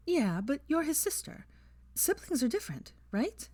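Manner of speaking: lightly voiced young woman, gentle; Hermione voice. A narrator's reading. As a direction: lift the voice for Hermione.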